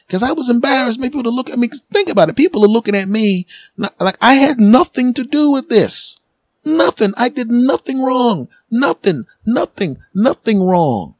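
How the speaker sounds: very high, insistent denial; indignant protest. Real, not acted. Comedian protesting he did nothing wrong, very high and indignant. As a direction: plead innocence to the crowd. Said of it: "protesting his innocence".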